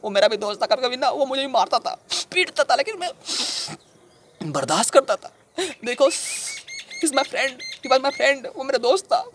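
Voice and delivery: high, wounded insistence; anguished pleading. Acted. Defending a friend who hit him, anguished pleading. Acted grief over violent friend.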